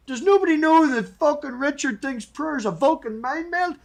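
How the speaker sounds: high, loud exasperated cry; exasperated outburst. Retold or imitated. Host venting about hour-long prayer talks, loud exasperated cry. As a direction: throw it up in frustration.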